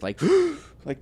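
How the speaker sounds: huge gasp for air; sharp gasp. Shown as a demonstration. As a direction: gasp like surfacing from water. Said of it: "the biggest gasp".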